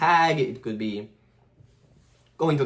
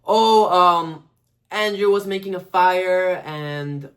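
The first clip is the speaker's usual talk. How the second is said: higher, slower mock-timid kid voice; imitating Peter; 4 semitones higher, 4 dB louder, much slower than usual. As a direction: play the nervous classmate, slowed and raised. Voicing Peter to the teacher.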